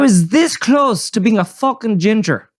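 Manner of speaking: hammed-up Irish brogue; horrible Irish accent. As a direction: greet the audience in a badly faked Irish accent.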